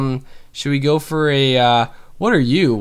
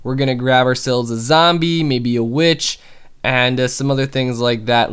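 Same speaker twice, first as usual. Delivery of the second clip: ordinary talk, nothing marked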